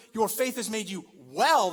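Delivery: "well" hit loud and drawn out; slow the phrase and land hard on "well"; stress on well. Shown as a demonstration. Preacher singling out an entirely different word, "well" stressed. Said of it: "'cause this word".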